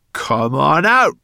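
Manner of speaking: slow, loud, high spat command. Acted. Brownie spitting at the hidden boy, slow and loud. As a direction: spit each word out, losing patience. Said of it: "spat Sorrel again".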